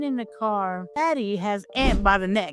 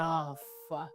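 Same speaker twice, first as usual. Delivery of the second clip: dread under the breath; 6 semitones lower, 12 dB quieter than usual. Retold or imitated. Dread at seeing the phone.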